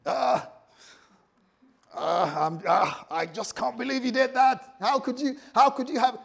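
high, loud wounded outburst; hammed-up aggrieved Jesus, loud and high; hurt rebuke. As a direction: scold like a betrayed friend. What Jesus didn't say to Peter.

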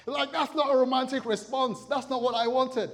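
high, loud comic protest; hammed-up outrage of a let-down spouse; mock indignation. Acts out disappointed spouse.

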